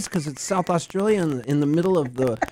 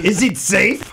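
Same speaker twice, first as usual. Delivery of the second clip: high, badly faked Australian gone Austrian; mock Austrian accent; 4 semitones higher, 4 dB louder than usual. Joking after being told it's Austrian, mock accent. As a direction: ham up a botched foreign accent. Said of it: "That's Austrian".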